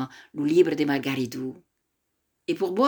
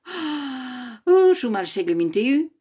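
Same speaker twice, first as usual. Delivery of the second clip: laughing; 9 semitones higher, 4 dB louder than usual. Acted. Grandmother laughs at the question.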